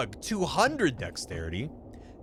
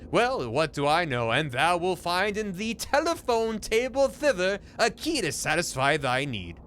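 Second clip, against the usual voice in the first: higher, mock old-fashioned formal speech; archaic landlord; 6 semitones higher than usual. Landlord demanding a signature, mock archaic. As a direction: speak like a stuffy Shakespearean landlord. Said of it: "talking like this".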